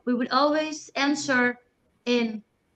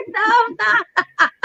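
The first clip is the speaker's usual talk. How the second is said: high, loud protest through laughter; laughing denial; 9 semitones higher, 7 dB louder than usual. Retold or imitated. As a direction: deny it while cracking up. Teasing denial to mother, laughing.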